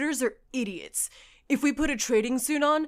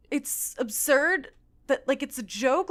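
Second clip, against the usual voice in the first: ordinary talk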